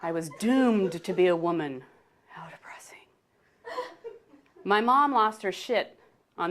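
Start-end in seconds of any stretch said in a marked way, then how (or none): 2.3-3.0 barely voiced, dejected aside; hushed little lament; whispered aside. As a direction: drop to a whisper. Aside after doomed to be woman.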